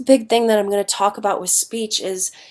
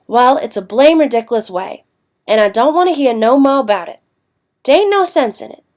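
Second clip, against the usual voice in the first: Huckleberry Finn's Jim in Southern dialect; thick Southern accent; 2 semitones higher, 4 dB louder than usual. Acted. Teacher reads Jim's protest aloud, thick Southern accent. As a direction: read it with dropped R's and a Southern accent. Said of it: "Jim has a very thick Southern accent".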